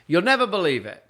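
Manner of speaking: imitated theatrical old lady; Swoopy breaking news. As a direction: announce a small mishap as big drama. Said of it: "and she said".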